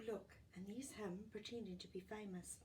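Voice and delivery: plain reading voice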